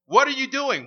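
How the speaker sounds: put-on a parent grilling a grown child, sharp and loud; prying parent. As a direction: demand it like a suspicious parent. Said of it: "My wife says this".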